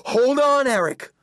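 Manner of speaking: high, loud warning cry; shouted. A narrator's reading. Brother warning the kid on a breaking branch, shouting. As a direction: yell it high and urgent. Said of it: "he shouted".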